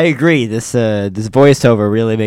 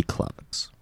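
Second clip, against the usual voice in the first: unvoiced, far softer whisper of the name; hushed reveal; 14 dB quieter than usual. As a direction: breathe the villain's name in dread. Repeated dramatic name reveal.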